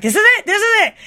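high, loud squeal of excitement; shrieking with delight as the couple finally gets together; excited squeal. Retold or imitated. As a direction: squeal it like a thrilled fan. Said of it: "And I'm like".

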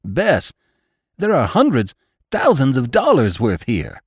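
high, emphatic, astonished outburst; excited amazement. A narrator's reading. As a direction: marvel at a sudden fortune. Venters astounded at the gold's worth.

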